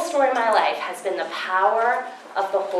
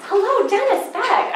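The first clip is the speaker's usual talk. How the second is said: playful greeting; 13 semitones higher, 4 dB louder than usual. Real, not acted. Calls out to audience member.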